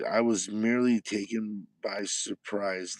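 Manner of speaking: normal talking voice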